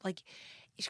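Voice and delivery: ordinary talk, nothing marked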